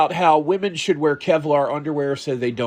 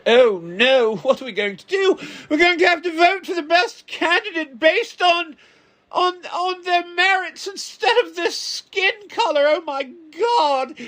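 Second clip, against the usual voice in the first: mock panic. Mock panic over court ruling.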